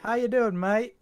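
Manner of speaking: high, loud hammed-up Australian; mock Aussie accent. Joking about the devs, a high mock Australian accent. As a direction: chirp it like a cartoon Aussie. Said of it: "our Aussie impressions".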